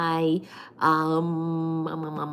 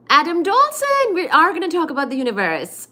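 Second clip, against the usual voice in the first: much higher, louder, fast hype about "Adam Dolson"; excited announcement; 8 semitones higher, 6 dB louder than usual. Real, not acted. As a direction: hype him up loud and high. Excitedly announcing a topic to viewer.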